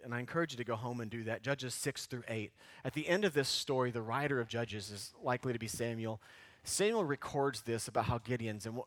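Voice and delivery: normal talking voice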